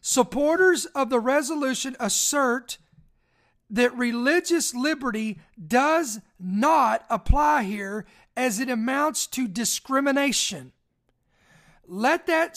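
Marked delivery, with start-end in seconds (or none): 0.0-10.7 slow, deliberate reading with pauses; really slow. Shown as a demonstration. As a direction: let every word soak in. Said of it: "Let me read this again really slow here".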